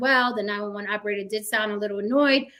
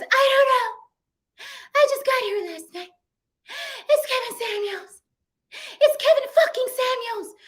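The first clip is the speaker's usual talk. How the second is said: mock sobbing yell, much higher and louder; hysterical crying; 13 semitones higher, 3 dB louder than usual. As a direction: wail it like she's falling apart on a 911 call. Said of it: "She is hysterical".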